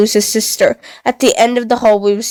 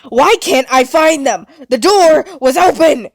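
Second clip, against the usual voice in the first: booming frustration; 5 semitones higher than usual. Acted. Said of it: "Pluto boomed".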